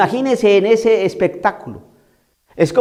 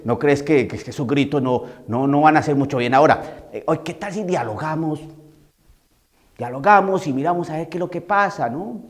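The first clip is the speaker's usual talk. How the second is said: soft, lower, meek negotiating voice; mock timid pleading; 4 semitones lower, 5 dB quieter than usual. Timid priest pleads with the possessed man, soft meek voice. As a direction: caricature a weak priest asking nicely. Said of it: "Estoy caricaturizando".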